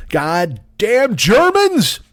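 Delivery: very slow, put-on griping veteran; mock grumble. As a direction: drag out a hammed-up curse. Mocking grumble, slowed down.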